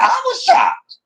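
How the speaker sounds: very high squeal of shock; mock-shocked squeal. Real, not acted.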